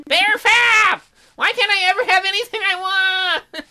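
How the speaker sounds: very high, loud sobbing bear; crying tantrum. Acted. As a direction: throw a crying fit like a toddler.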